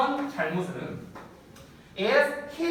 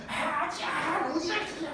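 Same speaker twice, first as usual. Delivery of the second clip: son throwing a fit; 9 semitones higher than usual. Retold or imitated. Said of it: "난리를 쳐".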